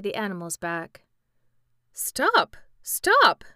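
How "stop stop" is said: higher, louder shout of Stop rising on the second. A narrator's reading. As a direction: cry out for him to stop. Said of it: "shouted the horse".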